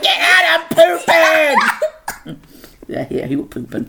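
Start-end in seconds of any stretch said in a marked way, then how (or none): 0.0-2.4 high, loud mock yell with a laugh; retold bathroom shout. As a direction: shout it through the door, dragging the last word.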